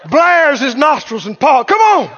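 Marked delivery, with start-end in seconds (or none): 1.6-2.1 high taunting challenge; mocking attitude. War horse daring the enemy to come, mocking. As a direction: dare the enemy with cocky swagger. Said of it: "Mocking is attitude".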